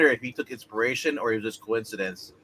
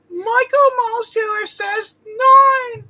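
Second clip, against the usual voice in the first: squeaky voice; 8 dB louder, much slower than usual. Acted. High put-on reading voice.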